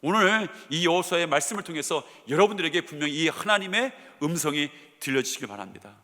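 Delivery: normal talking voice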